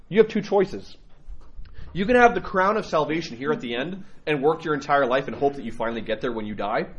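ordinary talk, nothing marked

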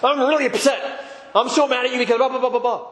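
high-pitched acted rant at someone; upset outburst. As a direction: pitch way up, hammed-up angry complaining. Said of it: "they're upset".

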